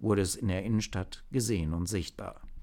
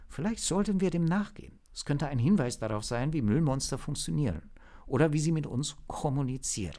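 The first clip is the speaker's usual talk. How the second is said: higher-pitched, lightly voiced agent; hesitant agent reporting; 7 semitones higher than usual. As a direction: share an odd observation with the team. (berichtende Agentin, etwas höhere Stimme)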